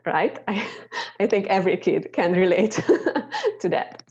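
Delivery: real laugh while talking; amused chuckling. Real, not acted. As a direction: break into a chuckle mid-sentence. Chuckles at the diary quote.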